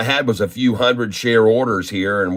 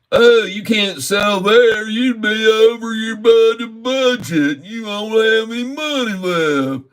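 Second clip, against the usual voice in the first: mocking the trading app; 11 semitones higher, slower than usual. Trader angrily parrots the app blocking his sale, slow high mock voice. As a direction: drag it out like a smug know-it-all machine. Quoting the app's refusal mockingly.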